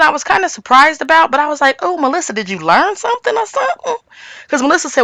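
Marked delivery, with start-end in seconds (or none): 1.8-4.0 high-pitched mock tease; high teasing voice. Mock teasing quote to Melissa.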